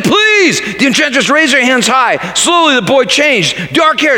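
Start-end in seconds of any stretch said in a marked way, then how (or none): 0.0-0.6 much higher, mock pleading cry; a lightly acted prince begging; pleading protest. A narrator's reading. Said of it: "the prince protested".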